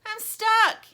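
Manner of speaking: high, loud distressed cry. Acted. Lumpy stuck in the snow, crying out. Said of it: "he cries".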